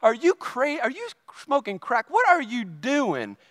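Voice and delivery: mock-mad scolding, pitch climbing; retold huffy anger. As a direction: act out huffing indignation, drawing out the final question. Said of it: "I'm all huffing and puffing and mad".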